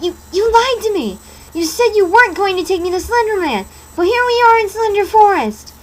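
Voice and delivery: high, loud angry shout; betrayed shout. Acted. Betrayed girl shouts at Masky, high and loud. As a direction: yell at him for lying. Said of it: "and shouted".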